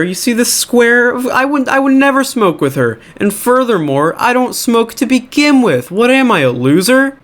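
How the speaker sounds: high-pitched mock indignation; indignant denial. T L O denying to the principal that she smokes, indignant.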